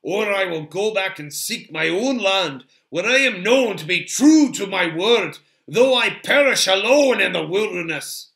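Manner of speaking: proud dwarf refusing to give way; obstinate Gimli. A narrator's reading. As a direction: declare it boldly, at full voice. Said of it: "Gimli was obstinate".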